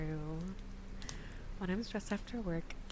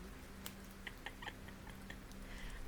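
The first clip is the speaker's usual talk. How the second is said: squeaky high laugh; high giggle; 12 dB quieter than usual. Real, not acted.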